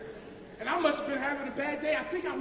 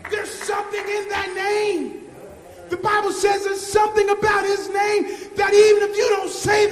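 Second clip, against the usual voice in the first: loud sermon climax, sung toward the end; full force preaching; 4 semitones higher, 14 dB louder than usual. Acted. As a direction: drive it home to the congregation.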